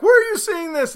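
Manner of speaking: quoting friends. Retold or imitated. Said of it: "started getting back".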